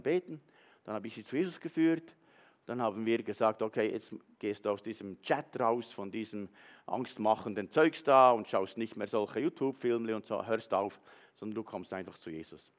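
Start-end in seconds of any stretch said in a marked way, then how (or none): none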